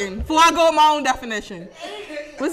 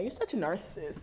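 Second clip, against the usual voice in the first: mocking quote; 5 semitones lower, 13 dB quieter than usual.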